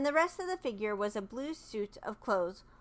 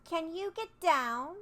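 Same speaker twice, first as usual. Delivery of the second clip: put-on curious girl; 6 semitones higher, slower than usual. Dorothy asks, higher.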